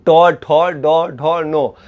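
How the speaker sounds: टो ठो डो ढो नो very slow, tongue curled back; slow sound demo. Shown as a demonstration. Teacher slowly demonstrates retroflex syllables for students. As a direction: drag out each retroflex syllable. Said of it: "जैसे टो ठो बोलिएगा तो स्पष्ट हो जाएगा".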